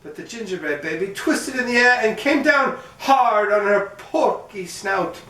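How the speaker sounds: ordinary delivery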